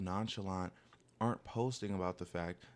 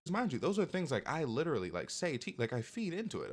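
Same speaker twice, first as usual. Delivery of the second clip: ordinary talk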